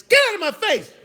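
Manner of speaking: very high, loud shooing yell; acted exasperated outburst; irritated shout. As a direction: blow up at a pestering kid. Acted shooing off a pestering kid.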